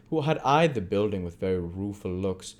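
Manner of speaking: ordinary delivery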